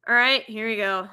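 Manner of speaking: ordinary talk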